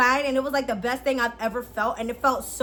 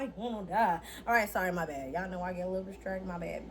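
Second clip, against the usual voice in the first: embarrassed mumble; 6 semitones lower, 10 dB quieter, faster than usual. Real, not acted. Embarrassed apology after outburst.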